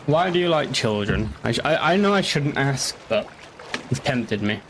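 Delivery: usual speaking voice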